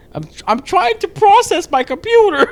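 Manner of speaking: high, loud, whiny protest; whiny self-imitation. Mimicking herself defending her lag to her boyfriend, high and whiny. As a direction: squeak it out like a frustrated gamer. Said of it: "And I'll be like".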